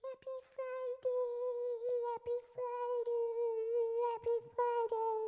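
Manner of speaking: squeaky cartoon kid gone rock screamer; very high squeal, like a tiny metal singer. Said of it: "Sounded like Bobby's World meets Twisted Sister".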